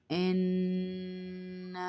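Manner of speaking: انا with the nun held in ghunna and the final alif stretched; انا chanted with ghunna and a long madd; drawn-out inna. Shown as a demonstration. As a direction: sing انا slowly, hum the nun and draw out the na. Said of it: "اب دیکھیے اس کو کیسے پڑھیں گے".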